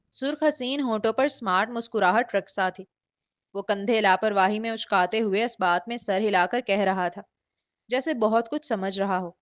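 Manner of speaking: ordinary delivery, nothing marked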